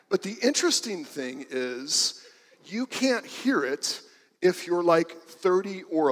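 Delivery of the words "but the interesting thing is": normal talking voice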